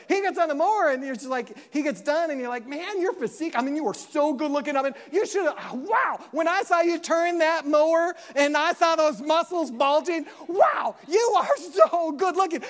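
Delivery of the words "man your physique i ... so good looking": hammed-up, louder, higher swooning praise; gushing admiration. Wife gushing over mowing husband.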